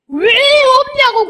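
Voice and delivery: loud high drawn-out mock protest; indignant demand. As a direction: stretch out an outraged, high-pitched WHY. Acting out people demanding love.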